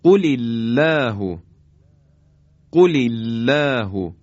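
slow, loud recited قل الله with the hamza of الله dropped in linking; slow measured tajwid reading of قل الله; Quranic recitation. Shown as a demonstration. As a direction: recite قل الله slowly, joining qul into illah.

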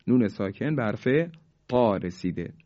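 normal talking voice

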